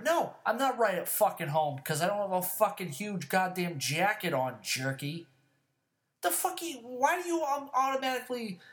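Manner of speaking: exasperated, high-pitched outburst; yelling rant. Real, not acted. Snapping at those who doubt he is cold, yelling. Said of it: "I'm just fucking yelling about it".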